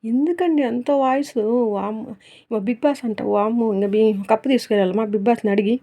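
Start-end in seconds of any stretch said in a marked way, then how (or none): none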